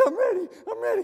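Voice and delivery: put-on high, loud eager kid; can't wait, high and loud; excited kid. Pastor as a third grader begging his brother, high and eager. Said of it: "I'm like".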